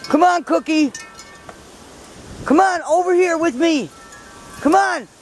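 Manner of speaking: high, carrying call to the dogs. Real, not acted. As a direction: call the dogs from across the yard. Calling dogs across snowy yard.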